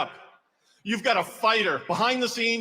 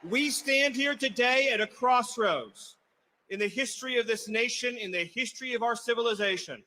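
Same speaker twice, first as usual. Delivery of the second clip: solemn rally speech. Acted. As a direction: weigh each phrase before the crowd.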